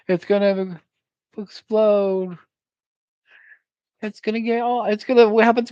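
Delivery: shrill frantic squeal, way up high; panicked high voice. Acted. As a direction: squeal it out like a panicking car nut. Panicked car owner imagined.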